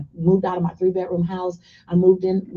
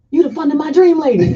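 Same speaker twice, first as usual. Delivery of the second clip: louder, higher burst of delighted recognition; excited recognition; 5 semitones higher, 9 dB louder than usual. Retold or imitated. Bank teller recognizing the customer, delighted and loud. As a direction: bank teller suddenly realizing who she is.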